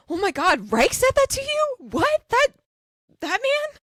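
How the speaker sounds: high, loud retold shock; outraged disbelief. Lo learning who insulted Garrison, acting out outraged disbelief. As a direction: shriek it in mock outrage, ready for a fight. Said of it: "ready to fight Reich".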